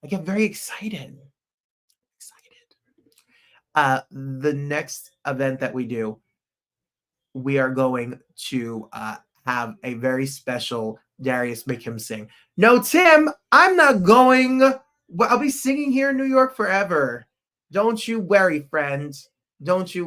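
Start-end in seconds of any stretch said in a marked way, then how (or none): 2.2-2.8 a breathy whispered excited; whispered echo. Real, not acted. Echoing excited, whispered softly to viewers. Echoing his own word. 12.6-16.5 high, loud, stretched protest; loud reassurance. Real, not acted. Answering viewer Tim with a loud high protest.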